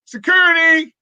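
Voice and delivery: high, loud playful yell; mock shout for security. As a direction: pretend to call security on a guest. Joking call for security.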